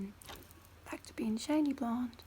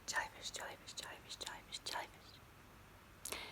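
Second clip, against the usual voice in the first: each angelfish softer than the last; fading whispered echo; 10 dB quieter than usual. Pretend. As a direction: breathe the word away three times.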